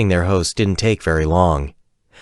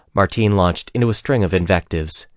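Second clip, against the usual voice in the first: plain reading voice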